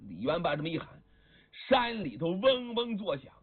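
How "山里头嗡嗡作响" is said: usual speaking voice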